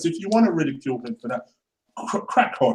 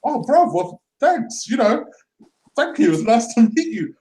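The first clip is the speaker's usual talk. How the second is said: higher, bashful thank-you; thanking the cinema worker, higher and bashful; flustered thanks; 6 semitones higher, slower than usual. Retold or imitated. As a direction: act a little flustered and pleased. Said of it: "I'm like".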